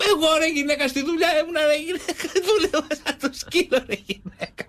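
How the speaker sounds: high, whiny husband pleading his case, cracking up; protesting husband. Acted.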